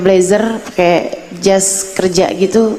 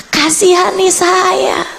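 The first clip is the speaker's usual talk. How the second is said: pleading prayer; 8 semitones higher, slower than usual. Retold or imitated. Desperate prayer.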